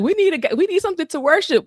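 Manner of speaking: high, insistent people's plea; mock demanding crowd. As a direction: beg for an idol like a restless crowd. People voiced demanding idol.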